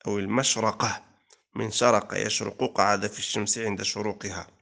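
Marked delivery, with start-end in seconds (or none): 0.2-1.1 المشرقة said with "a" after the ra, mashraqah; fatha on the ra. Shown as a demonstration. Teacher showing the second word's forms, fatha on the ra of المشرقة. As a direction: sound المشرقة as mash-RA-qa. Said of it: "التي جاءت بالفتح".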